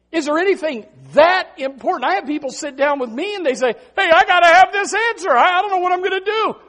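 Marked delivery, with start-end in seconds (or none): 3.9-6.5 high, loud, urgent outburst; mock desperation. People sit down with pastor needing answers, mock desperate urgency. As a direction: cry out as if at wits' end.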